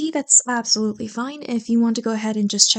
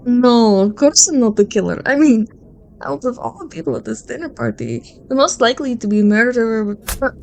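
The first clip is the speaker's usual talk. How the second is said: louder, mocking denial that trails into hesitation; teasing accusation; 4 dB louder than usual. Friend at the dinner party pinning the murders on the listener, teasing. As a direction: turn the blame on them playfully.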